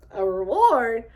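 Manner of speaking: loud, put-on "A reward?"; incredulous. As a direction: throw his word back in disbelief. Shocked he wants money.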